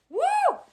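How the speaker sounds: joyful high whoop. Real, not acted. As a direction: let out a big woo. Cheer after the shout.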